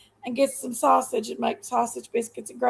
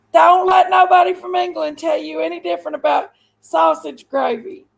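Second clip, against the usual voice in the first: higher and louder, yelled out in defiance; loud shout; 3 semitones higher, 6 dB louder than usual. Real, not acted. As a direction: belt it like a rallying cry. Shouted repeat of gravy comment.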